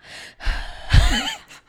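exaggerated wordless exhale; mock sigh. Imitating Rachel's speechless reaction to the insults, mock sigh. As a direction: heave a sigh, imitating someone else. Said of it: "Rachel's just like".

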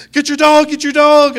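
high, loud frightened yell; panicked shout. Retold or imitated. Scared visitor yelling at the pastor to get his dog.

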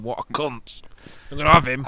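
imitating Bronson. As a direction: grumble it then swell to a loud threat. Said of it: "He's still sat there thinking".

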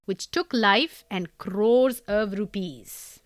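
reading the letter in her own Indian English accent; letter read aloud in Indian English, deliberate. Shown as a demonstration. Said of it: "I'm gonna do this in an Indian English accent".

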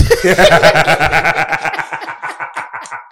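loud, high burst of laughter; long laugh. Real, not acted. Laughing at his own story.

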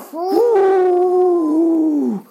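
long loud whoosh of breath; blowing fire. Acted. Dragon finally breathes fire, a loud whoosh.

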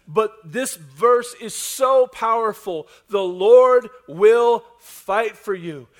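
preaching at full force. Acted. Preacher rallying the congregation, full-force preaching. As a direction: drive it home to the whole room.

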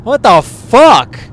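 high, loud, slow what the fuck; shocked. Real, not acted. Friend reacting to the story, high and shocked. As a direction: drag it out in disbelief.

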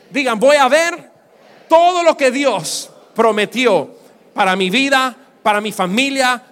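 loud, rousing call to declare; preaching at full force. Acted. Pastor leading the crowd in declaration, loud and rousing. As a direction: lift the church into a shout.

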